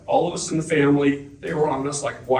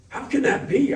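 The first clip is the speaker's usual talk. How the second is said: astonished; 12 semitones higher than usual. Retold or imitated. Retold astonishment at recruiter.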